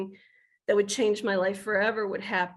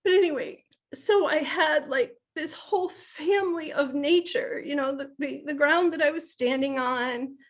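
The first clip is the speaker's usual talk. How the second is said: higher-pitched, choking back emotion; real welling emotion, voice lifting higher; choked up; 6 semitones higher than usual. Real, not acted. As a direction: talk on while fighting back tears. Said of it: "I'm gonna get choked up".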